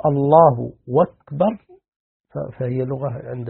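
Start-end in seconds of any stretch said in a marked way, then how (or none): 0.1-1.6 "أكبر" said slowly as "wakbar", w for hamza; hamza as waw. Shown as a demonstration. Sheikh explaining the accepted form, slow "wakbar" for "أكبر". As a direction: draw out الله, then "wakbar" without glottal stop. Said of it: "أبدل الهمزة إلى واو".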